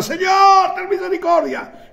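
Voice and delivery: high-pitched desperate plea; wailing, drawn-up call to the Lord; desperate cry. Retold or imitated. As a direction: cry out for mercy like a desperate woman. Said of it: "gritos como una desesperada".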